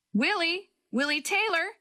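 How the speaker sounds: high calls from far away in the woods; shouting name. A narrator's reading. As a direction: call out searching for a lost boy. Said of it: "Several voices were shouting Willie's name".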